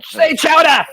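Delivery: high, loud cartoon bullying demand; Mayor Quimby. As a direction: shout it as a furious cartoon mayor. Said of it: "he's just slapping the crap out".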